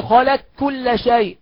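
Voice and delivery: "خلق كل" slowly as khalak-kulla, no trace of q; a pure double k replacing the ق of "خلق"; full merging. Shown as a demonstration. Teacher insisting on complete merging, "خلق كل" as khalak-kulla. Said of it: "لازم تدغم لي القاف في الكاف إدغام كامل".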